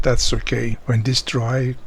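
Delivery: ordinary talk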